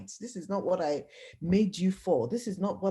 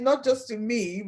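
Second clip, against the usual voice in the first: normal talking voice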